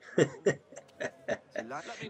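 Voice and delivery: bursting into a real laugh; a quick amused laugh. Real, not acted. Streamer laughs at the scene.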